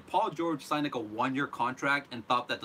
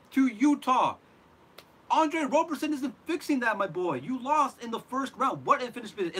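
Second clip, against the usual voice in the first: ranting; 9 semitones higher, 4 dB louder than usual. Real, not acted. Fan ranting at Paul George about the first-round loss, loud and exasperated. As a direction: hammer every point like a fed-up fan. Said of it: "I need to rant about this".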